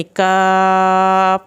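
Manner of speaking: "کاف" with a long, level, held vowel; elongated madd. Shown as a demonstration. Teacher showing students the obligatory madd, elongated "کاف". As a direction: draw out "کاف" slowly on one sustained note. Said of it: "اس کی مد واجب بھی properly ادا کرنی ہے".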